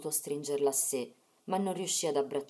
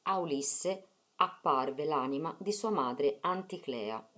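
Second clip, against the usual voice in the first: usual speaking voice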